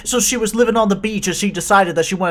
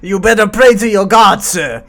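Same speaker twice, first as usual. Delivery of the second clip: threatening shout; 4 semitones higher, 11 dB louder than usual. Acted. Acted threat to Tom.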